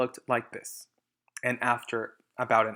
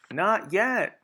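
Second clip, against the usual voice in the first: higher, louder quick shout; 8 semitones higher, 6 dB louder than usual. Acted. Boggis shouting to Bean over the noise, higher and louder. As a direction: shout it across. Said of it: "shouted Boggis".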